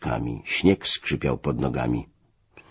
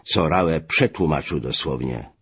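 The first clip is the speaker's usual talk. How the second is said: plain reading voice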